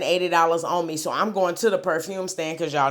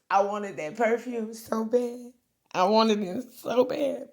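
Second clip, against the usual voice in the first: high, slow, whiny mock longing; mock whine; 6 semitones higher, slower than usual. A touch of mock whining over the perfume she wanted. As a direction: whimper it like a spoiled baby.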